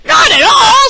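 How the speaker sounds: high, loud protesting shout; a storybook dad shouting them down. Acted. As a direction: yell it back at the others. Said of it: "shouted Lunchbox Louie".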